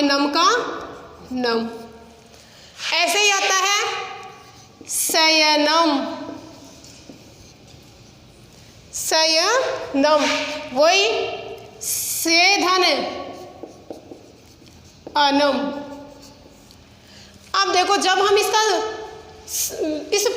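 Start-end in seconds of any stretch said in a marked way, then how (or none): 4.9-10.2 सयनम drawn out syllable by syllable, very slow; teacher spelling सयनम aloud slowly; slow sounding out. Shown as a demonstration. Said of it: "teacher sounds out the word". 11.8-15.6 slow splitting. Shown as a demonstration. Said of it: "teacher splits word slowly".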